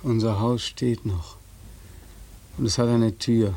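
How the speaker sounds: picture the door as you speak; a soldier dreaming aloud of his mother's door; dreamy reverie. Acted. Beckmann's low dreamy monologue.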